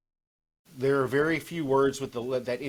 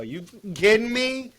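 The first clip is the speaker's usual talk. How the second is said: loud, much higher Jersey "You kidding me?"; Jersey outburst; 10 semitones higher, 6 dB louder than usual. Retold or imitated. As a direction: blurt it like a wound-up Jersey guy. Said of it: "Benny drops the G".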